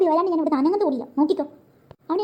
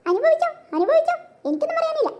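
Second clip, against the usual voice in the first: high, singsong told-you-so; high gloating serves-you-right; gloating taunt; 9 semitones higher than usual. Acted. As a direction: rub it in with glee. Gloating at Toby's trouble.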